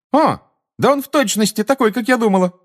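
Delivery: put-on very high-pitched excited young witch; high, delighted-to-see outburst; excited exclamation. A narrator's reading. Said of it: "воскликнула чародейка".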